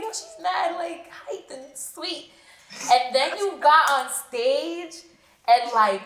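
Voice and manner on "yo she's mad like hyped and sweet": high-pitched, drawn-out retelling of being impressed; retold amazement. Host recalls first seeing the guest with her camera, mock amazement. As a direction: play back your first impression in a higher voice.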